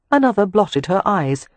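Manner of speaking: ordinary delivery, nothing marked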